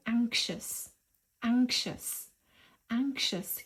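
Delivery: anxious said slowly as ANK-shus, stress on the first syllable; pronunciation model. Shown as a demonstration. Teacher introducing anxious to learners, said slowly and clearly. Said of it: "حابة اليوم أعرفكم عليها".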